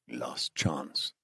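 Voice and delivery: put-on low, hushed Iranian-accented menace; quiet captor's threat; accented interrogator. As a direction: deliver a soft, final warning with a thick accent. Captor gives up on prisoner.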